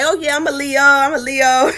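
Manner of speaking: jokingly. Pretend. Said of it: "I jokingly say".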